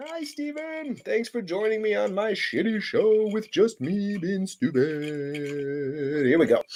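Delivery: high, soft put-on voice; drawn-out, small high-pitched hello; mock greeting.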